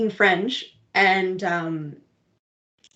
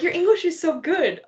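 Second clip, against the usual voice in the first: mock amazement; 9 semitones higher than usual. Mimics impressed French speakers.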